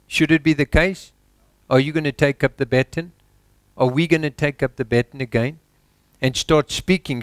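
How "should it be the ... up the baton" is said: ordinary talk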